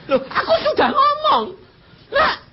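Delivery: high, loud laughing exchange with God; laughing quote. Retold or imitated. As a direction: laugh as you act both sides. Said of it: "aku tadi bilang gini".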